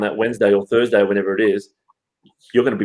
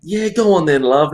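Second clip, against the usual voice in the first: indulgent seller; 6 semitones higher than usual. Retold or imitated. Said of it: "they're like".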